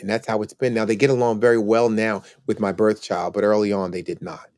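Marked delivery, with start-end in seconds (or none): 3.9-4.5 drops to a hushed whisper; whispered aside. Real, not acted. Father quietly admitting the kids clashed, whispered. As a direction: breathe it out, barely voiced. Admitting early sibling friction.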